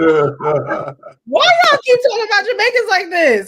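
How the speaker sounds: loud, high-pitched squeal through laughter; laughing protest. Real, not acted. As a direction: cry out in mock exasperation, cracking up. Laughing protest at teasing about Jamaicans.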